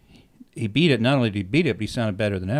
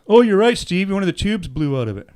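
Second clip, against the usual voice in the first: high, loud surprised "oh" that settles; startled admission, loud at the start; sudden realization; 8 semitones higher, 6 dB louder than usual. Retold or imitated. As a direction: tech catching on mid-sentence. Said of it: "he goes".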